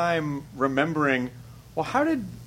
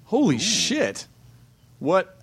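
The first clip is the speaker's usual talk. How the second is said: drawn-out, high, stunned reaction; genuinely floored, pitched up; real astonishment; 6 semitones higher, much slower than usual. Real, not acted. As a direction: blurt it in disbelief, stretching the words. Stunned on hearing he lives in Tasmania.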